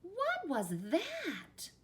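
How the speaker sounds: high, drawn-out astonished question; exclaiming. A narrator's reading. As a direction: ask it slowly in startled amazement. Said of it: "exclaimed Skippy John Jones".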